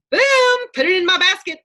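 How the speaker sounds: high, loud burst of triumph; excited self-talk. Retold or imitated. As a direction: cheer it like you just won. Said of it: "This is the way I talk to myself".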